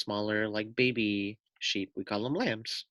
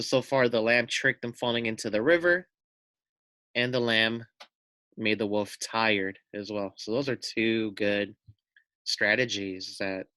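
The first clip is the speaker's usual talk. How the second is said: ordinary delivery, nothing marked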